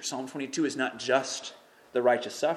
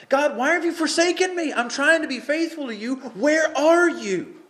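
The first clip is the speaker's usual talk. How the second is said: desperate cry; 10 dB louder than usual. Acted. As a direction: plead like he's been abandoned. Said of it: "He's crying out".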